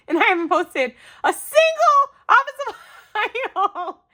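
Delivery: very high, loud, breaking into laughter; giddy laughing protest; laughing confession. Real, not acted. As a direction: squeal through the laughter. Laughing confession about secret hauls.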